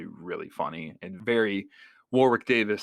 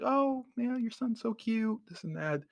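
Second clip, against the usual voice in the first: high, gushing mom voice, softer; imitating mom; 14 semitones higher, 5 dB quieter than usual. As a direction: coo at him like an excited mother. Mom voiced talking to Davis.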